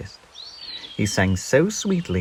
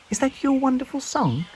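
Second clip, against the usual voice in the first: small bird voice. Acted. Peep voiced high.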